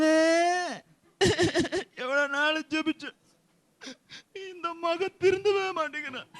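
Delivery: high, bleating fake wail; mock crying. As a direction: sob out a complaint, hammed up. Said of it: "அழுதுட்டு போவீங்களா". (போலியாக அழுது புலம்பும் குரல்)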